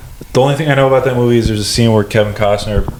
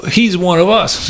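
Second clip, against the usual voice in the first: higher-pitched, mock-impressed border guard; put-on voice of a fooled official; imitated Australian official; 9 semitones higher than usual. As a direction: play a guard won over by the password. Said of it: "And they're like".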